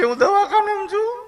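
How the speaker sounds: crying teacher. Retold or imitated. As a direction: whimper it like a teacher in hospital, hammed up. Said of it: "બેન રોતા રોતા બોલ્યા". (દવાખાનેથી રડતી શિક્ષિકાનો ખૂબ ઊંચો નાટકીય અવાજ)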